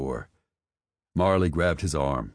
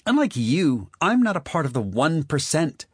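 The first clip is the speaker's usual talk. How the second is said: high, louder waspish retort; Marley, higher and louder, biting back; waspish snap; 12 semitones higher, 5 dB louder than usual. Acted. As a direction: snap back at him, stung. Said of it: "she snapped back waspishly".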